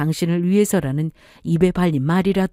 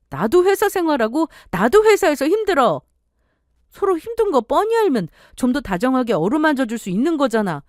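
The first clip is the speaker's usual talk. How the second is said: much higher, upset wife arguing back; wife raising her voice; 6 semitones higher than usual. Acted. Wife arguing back at husband, higher and upset. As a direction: fire back, hurt and indignant.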